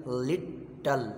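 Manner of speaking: "लिटल" broken as लिट, टल; "लिटल" with a clear gap between लिट and टल; split into syllables. Shown as a demonstration. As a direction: say "लिटल" as two separate syllables. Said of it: "लिट और टल जो है ना ये दो".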